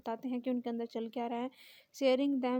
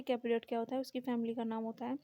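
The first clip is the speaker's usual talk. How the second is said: normal talking voice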